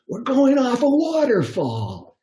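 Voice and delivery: high, slow, panicked wail; panicked cry. Retold or imitated. Friend on the inner tube spots the waterfall, panicked wail. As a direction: cry it out in mock terror.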